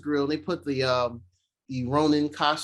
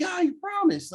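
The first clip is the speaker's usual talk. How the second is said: put-on high-pitched little-girl voice; mock daughter, pitched way up; imitated daughter. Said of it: "the daughter's like".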